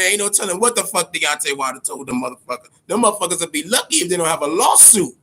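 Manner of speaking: ordinary delivery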